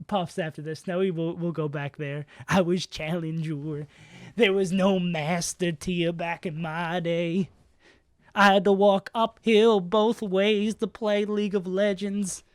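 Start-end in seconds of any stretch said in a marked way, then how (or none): 2.5-12.4 put-on slow Southern old man drawl; slow Southern accent, a frail old gentleman reminiscing; dying southern gentleman. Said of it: "I sound like a southern, a dying southern gentleman".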